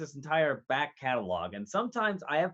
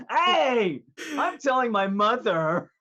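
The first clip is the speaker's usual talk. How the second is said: high, loud slow tattling whine; hammed-up childish complaint; whiny kid; 8 semitones higher, 8 dB louder, slower than usual. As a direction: wail it like a kid running to mom. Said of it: "I'm like".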